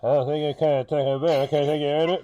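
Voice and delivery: mock drive-thru intercom garble; drive-thru speaker voice. Co-host imitating the menu man as a speaker box, garbled intercom voice. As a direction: garble it like a tinny order speaker. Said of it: "did he do the whole change his voice like".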